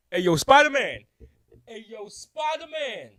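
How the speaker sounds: loud, high holler across a crowd; imitated yelling. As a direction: imitate a rib vendor yelling for someone's attention. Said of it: "this guy's yelling at me".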